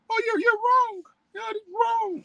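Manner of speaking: high falsetto mocking woman; mock protest. Preacher mimicking offended women answering him, high mocking falsetto. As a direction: squeal the objection back in a silly put-on woman's voice.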